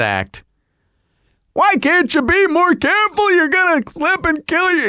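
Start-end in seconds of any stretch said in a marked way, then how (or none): 1.5-4.9 very high-pitched riot-act imitation; mock scolding. Elderly husband scolds wife who slipped and fell, mock scolding. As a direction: scold frantically in a much higher voice. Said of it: "reads her the flippin' riot".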